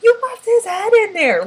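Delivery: high, loud horrified cry; horrified yell. Retold or imitated. Girl yelling at friends who left the head behind, horrified high cry. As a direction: shriek it at them in horror. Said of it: "the girl's like".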